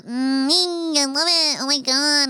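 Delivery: high, cooing campy gush. Retold or imitated. Date admiring her place, mocked as high and gushing. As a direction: coo adoringly in a mock effeminate voice.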